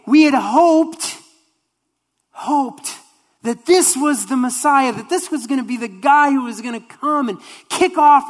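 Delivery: high, straining, wistful disciple voice; dashed hopes lament. Acted. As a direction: heartbroken disciple telling the stranger their lost hopes.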